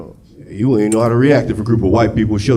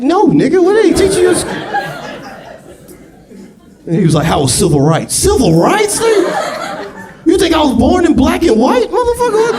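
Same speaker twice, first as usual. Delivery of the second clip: outraged yell. Retold or imitated. Stepfather ranting at his stepson's questions, outraged. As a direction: rant at the boy in disbelief. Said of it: "It's like".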